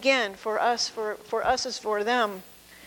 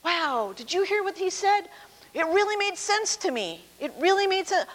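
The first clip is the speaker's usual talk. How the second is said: raised, wide-eyed marvelling; amazed crowd; 7 semitones higher than usual. Retold or imitated. Pastor voicing the amazed crowd, high marvelling. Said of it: "the people were going".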